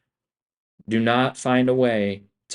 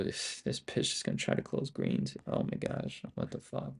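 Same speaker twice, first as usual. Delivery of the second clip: hushed, stunned muttering; whispered disbelief; 12 dB quieter than usual. Real, not acted. Trader watching the chart turn, whispering in disbelief. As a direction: breathe it out in disbelief.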